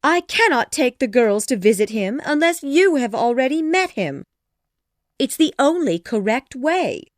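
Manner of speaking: high, loud, pleading wife character; agitated Mrs. Bennet; exasperated wife. A narrator's reading. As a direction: insist shrilly to your stubborn husband.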